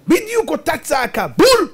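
high, punchy burst ending loud; emphatic exclamation. Real, not acted. Preacher proving both cries were identical, exclaiming emphatically. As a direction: hit the last word like a bullseye.

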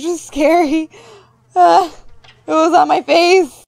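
high, loud rattled squeal of complaint; creeped out. Real, not acted. Player startled by a cockroach on the face, creeped out. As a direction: react with a jolt, pitch jumping up.

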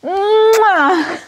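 high, drawn-out smooch; big exaggerated mwah; loud kiss sound. Real, not acted. Thanking husband for the shoes, big kiss sound.

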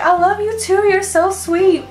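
very high, gushing affection; imitating a sweet, high-pitched reply; Jacqueline. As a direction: coo it back adoringly. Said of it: "And she's like".

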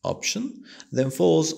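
"option" lifted high, then "falls" coming down; high rising "option" before "then falls"; rise then fall. Shown as a demonstration. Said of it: "intonation rises on the first".

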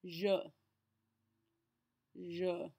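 je with a clear "uh" vowel; isolated French je, ending in "uh"; correct vowel sound. Shown as a demonstration. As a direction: model je alone as one careful syllable. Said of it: "get the correct vowel sound".

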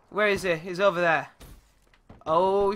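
ordinary talk, nothing marked

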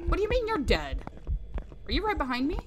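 panicked rising voice; being chased. Real, not acted. Ghost hunting them down.

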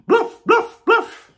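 very high, loud yaps; tiny dog barks. A narrator's reading. Reading to kids, yapping like a tiny Dutch dog. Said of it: "Dogs in Holland bark".

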